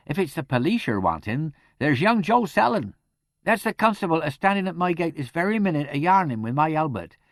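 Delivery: high, loud, countrified chatter; garrulous charwoman. A narrator's reading. Charwoman telling where the constable is, garrulous and insistent.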